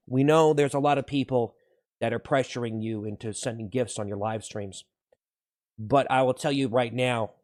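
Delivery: low, soft pledge to viewers; hushed, low sincerity; earnest reassurance. Pretend. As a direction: reassure them quietly and solemnly. Mock-earnest wholesome pledge to viewers.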